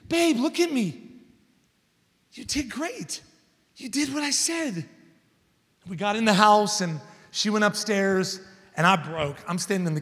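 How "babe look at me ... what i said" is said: put-on soft, high soothing voice to a scared child; calm her gently, voice lifted and hushed; tender reassurance. Calming his daughter after rescue.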